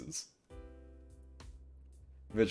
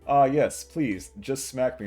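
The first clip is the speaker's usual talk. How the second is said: mock eager plea; 4 semitones higher, 7 dB louder, slower than usual. Mocking eager fans.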